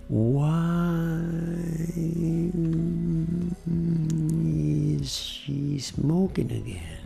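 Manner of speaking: hugely drawn-out "Why", soft voice; train effect. Shown as a demonstration. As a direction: hold "Why" for seconds, then let the rest pass. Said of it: "ficar um tempão falando a primeira e a segunda". (efeito trem, "Why" bem esticado)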